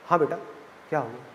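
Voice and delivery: mother answering low and soft; a gentle, hushed mom on the phone. Acted. Mother answers daughter's phone call. (Phone पर धीमी, नरम माँ की आवाज़)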